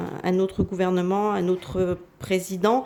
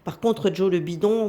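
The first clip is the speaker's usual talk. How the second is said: ordinary talk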